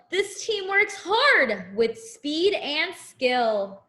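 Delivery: sing-song picture book rhyme; reading to a child. Acted. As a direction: read it like a bedtime rhyme.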